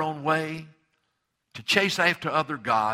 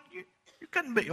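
mock Pharisee. Pharisees doubting Jesus, mocking voice.

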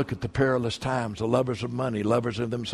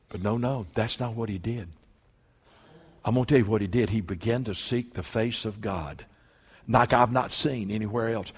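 ordinary talk, nothing marked

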